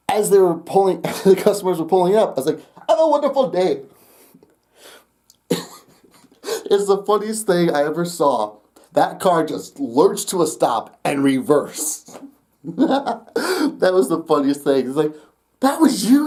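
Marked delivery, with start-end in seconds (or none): 2.9-3.8 much higher put-on voice; sing-out, high-pitched customer send-off; put-on farewell voice. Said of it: "I like to practice doing my voices". 15.6-16.3 high, mock-amazed question; astonished customer.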